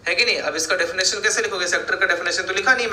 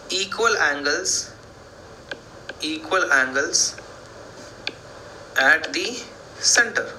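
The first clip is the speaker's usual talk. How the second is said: slow dictation; much slower than usual. Shown as a demonstration. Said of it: "dictating the theorem".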